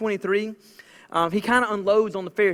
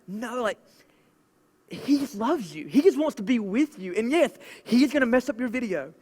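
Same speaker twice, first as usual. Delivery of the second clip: usual speaking voice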